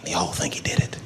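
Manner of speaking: conspiratorial whisper. Acted. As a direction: let them in on a secret. Confiding aside to audience.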